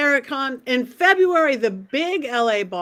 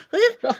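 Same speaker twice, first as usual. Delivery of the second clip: laughing; 9 semitones higher than usual. Real, not acted. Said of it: "your very best overdone Southern accent".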